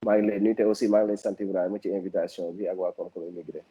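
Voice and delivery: ordinary talk, nothing marked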